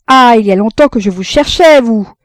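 loud, high exclamation on finding him; exasperated relief. Acted. Frédéric finally finds the man he sought, loud and exasperated. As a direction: burst out at the man you hunted for.

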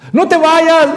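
put-on very high, loud panicked cry of a child; father reenacting his son yelling as the door shuts; child's scream. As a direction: scream it like a terrified little boy. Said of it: "me gritó".

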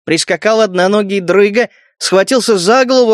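plain reading voice

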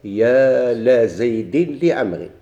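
يا لا زيد لعمرو spoken very slowly, word by word; slow example. Shown as a demonstration. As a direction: lay the example out slowly for students. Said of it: "نقول مثلًا". (قراءة بطيئة للمثال)

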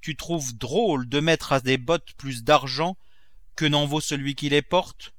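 a hint of an outraged master; indignant miser Grandet.

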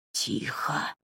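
sharp whispered hush; hissed whisper. A narrator's reading. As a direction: hiss it into her ear. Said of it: "прошипел ей в ухо незнакомый голос".

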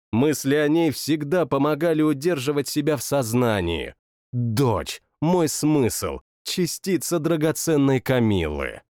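usual speaking voice

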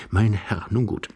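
ordinary delivery

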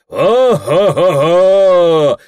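slow booming bass call, as from a barrel; booming deacon voice. Acted. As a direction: boom it out like a deacon. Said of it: "гудит дьякон, как из бочки".

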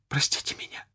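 soft, whispered sob; sobbing plea. A narrator's reading. Son begging his father for forgiveness, sobbing. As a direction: beg forgiveness through tears. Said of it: "рыдая".

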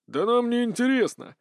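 ordinary delivery, nothing marked

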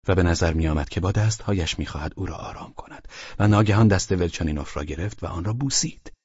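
ordinary delivery

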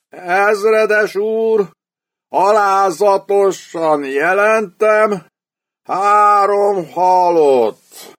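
high, very slow, broken voice of a shattered sergeant; collapsing terrified report. A narrator's reading. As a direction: gasp it out as he collapses. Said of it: "csuklott össze a sasszemű hadfi". (magasan, nagyon lassan, összeomolva jelenti)

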